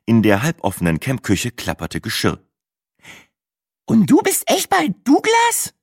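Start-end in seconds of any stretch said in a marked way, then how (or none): 3.9-5.7 extremely high, squeaky repeated question; incredulous Brenda. Acted. Brenda repeats her Douglas question, high-pitched and awestruck. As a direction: squeak it out, still amazed. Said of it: "wiederholte Brenda".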